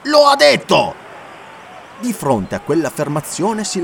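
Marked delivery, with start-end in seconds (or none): 0.0-0.9 very loud, much higher shout; triumphant yell. Acted. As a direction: drive the point home over the crowd. Corinthian shouting his point.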